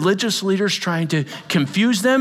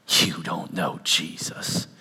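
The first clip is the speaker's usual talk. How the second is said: low rebuke; 7 dB quieter, slower than usual. Real, not acted.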